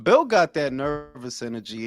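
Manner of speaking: ordinary talk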